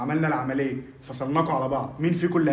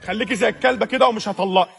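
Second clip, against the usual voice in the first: mocking angry retort; 6 semitones higher, 4 dB louder than usual. Parents' quarrel over divorce.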